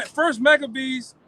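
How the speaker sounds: ordinary talk, nothing marked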